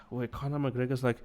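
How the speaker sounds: usual speaking voice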